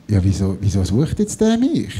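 low, innocent question; playing innocent. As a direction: feign ignorance.

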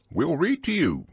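bright, eager offer to help; pep him up cheerfully. Acted. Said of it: "said Rabbit cheerfully".

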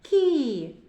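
кі sounded out on its own; first sound isolated. Shown as a demonstration. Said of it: "Перший звук".

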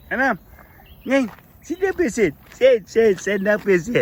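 high playful coo at a foal. Real, not acted. Man coos at his baby donkey, high playful baby talk.

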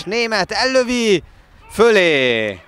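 high, loud slow call, then a shout; excited sports commentary. Real, not acted. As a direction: stretch the shot, shout the miss. Late chance shot over.

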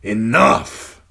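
loud, rasping bark; rasped shout. Acted. Soldier stopping the beating, loud rasp. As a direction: cut in rough and hard. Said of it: "the hound rasp".

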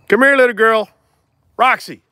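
high, loud call to a puppy. Real, not acted. Dog breeder calling his puppy to come, loud and high. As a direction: call her over from across the yard.

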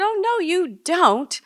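loud, high protest; indignant retort. Retold or imitated. Daughter turning round on her dying father, indignant. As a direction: cut them off with a loud objection.

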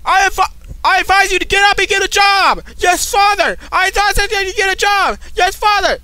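high, very loud drill-style shouting; mock sergeant. Son imitating his Navy father's orders, mock sergeant shouting. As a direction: bellow it like a military order and reply. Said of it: "'Cause, 'cause he's a sergeant".

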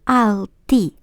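"Alltid" said as "alti", final d dropped; silent final d. Shown as a demonstration. Teacher models "Alltid" without the final d. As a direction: say "Alltid" with no d at the end. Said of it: "ми не вимовляємо останню літеру Д".

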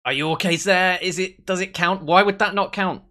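high, loud, stumbling protest; incredulous questioning. Real, not acted. Gamer disputing whether the goal counts, incredulous.

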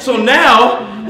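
loud, high, building up; preaching loud. Real, not acted. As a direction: drive into the point at full voice.

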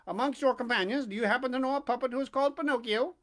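a hint of a brisk bird character; pigeon's voice. A narrator's reading. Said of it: "continued the pigeon".

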